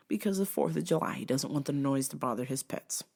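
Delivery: ordinary talk, nothing marked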